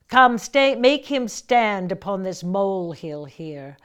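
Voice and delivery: loud, ringing queen's verse slowing at the end; declaimed Shakespeare. Shown as a demonstration. Actor demonstrating Margaret taunting captured York, loud and grand. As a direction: command the field as Margaret, then slow down. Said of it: "I'll just do about four lines and show you what I mean".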